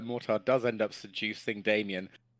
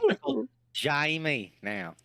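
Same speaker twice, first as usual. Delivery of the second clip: slow Kiwi vowels, Jamie as Shamie; New Zealand accent; 4 semitones higher than usual. Pretend. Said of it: "get into the New Zealand accent".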